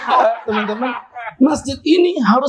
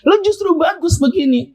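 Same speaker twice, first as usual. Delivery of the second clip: excited insistence; 7 semitones higher, 4 dB louder than usual. Real, not acted. Excited counter to the apology.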